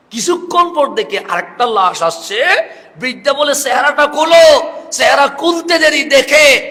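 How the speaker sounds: high, loud, building to a shout; full-force preaching. A narrator's reading. Preacher tells of the old woman facing another body, full-force preaching. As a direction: drive the story to its climax at full voice.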